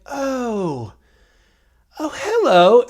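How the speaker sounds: put-on high, surprised old-man voice; waking mummy. Mummy wakes in sarcophagus.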